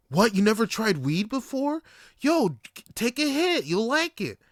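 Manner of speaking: high, pushy mock voice of a date; pushy exclamation. Date pressing him to take a hit, pushy.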